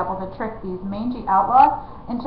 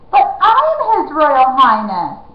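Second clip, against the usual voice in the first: loud, slow royal insistence; indignant; 5 semitones higher, 7 dB louder, slower than usual. Acted. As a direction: insist on your rank. Prince insists.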